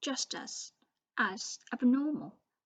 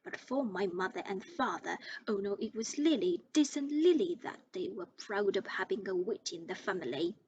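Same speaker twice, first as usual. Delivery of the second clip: ranting. Acted. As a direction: pour out years of resentment. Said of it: "went ranting on".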